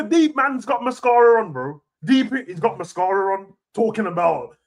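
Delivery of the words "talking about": ordinary delivery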